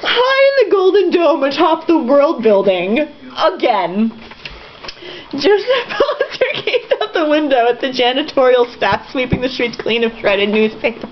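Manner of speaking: reading aloud between real laughs; laughing while reading. Real, not acted. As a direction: try to read through the laughter.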